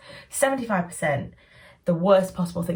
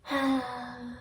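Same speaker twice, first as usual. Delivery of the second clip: weak sigh; 5 semitones higher, 7 dB quieter than usual. Retold or imitated. Mother's feeble response.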